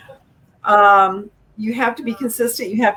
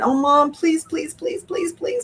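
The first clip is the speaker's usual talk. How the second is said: high-pitched mock kid pleading on and on; whining, begging child; 10 semitones higher, slower than usual. Kid begging Mom to give in, in a high mock whine. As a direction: wheedle like a kid wearing Mom down. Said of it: "the whining and the crying".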